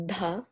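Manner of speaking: "Duh" as a soft breath-released dh; DH sound. Shown as a demonstration. Guest demonstrating the first sound of her name, DH sound. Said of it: "a D H, so it's like".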